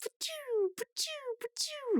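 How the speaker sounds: very high, soft squeaky zaps in a row; laser zap sounds. Retold or imitated. Imitating Rigby firing lasers, soft squeaky zaps. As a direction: fire off squeaky laser noises like a shotgun. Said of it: "he's just going".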